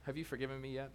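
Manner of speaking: usual speaking voice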